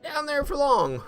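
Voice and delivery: high, fumbling over the tongue twister; tongue-tied. Real, not acted. Gamer stumbling over dilly-dally, high tongue-tied. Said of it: "Tongue twister".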